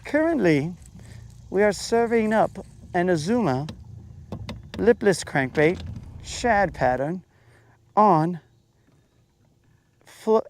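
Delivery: high, mock-elegant waiter reciting the menu; fancy waiter. Fisherman playing a waiter for viewers, high mock-elegant. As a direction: present the lure like a fine dish. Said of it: "And I'm like".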